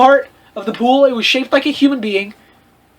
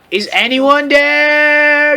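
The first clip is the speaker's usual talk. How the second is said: slow, stretched call into the dark; drawn-out yell; 5 semitones higher, much slower than usual. Acted. Janitor calling out slowly into the dark. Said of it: "yelled Mister Norris".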